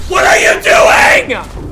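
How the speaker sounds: slow loud exasperated yell; angry shout. Real, not acted. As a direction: bellow at the teammate. Teammate shot him.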